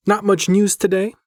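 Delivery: ordinary delivery, nothing marked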